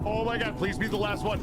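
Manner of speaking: ordinary talk, nothing marked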